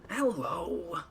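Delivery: high cartoon snowman hello; Olaf. Attempting an Olaf impression for listeners, high snowman voice. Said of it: "An Olaf impression".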